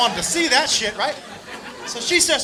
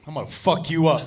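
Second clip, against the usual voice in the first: mock menace; 8 semitones lower, 3 dB quieter than usual. Buddy snaps and threatens the woman, low mock menace. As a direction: imitate quiet, dangerous anger.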